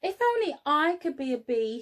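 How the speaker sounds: a hint of a longing bear; sighing bear. Norman the bear wishing, a touch of a sigh. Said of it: "he sighed".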